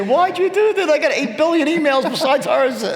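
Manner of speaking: high mock exasperated squeal, laughing; squeaky fake outrage from an overloaded assistant; Matt exasperated. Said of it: "Matt's gonna say".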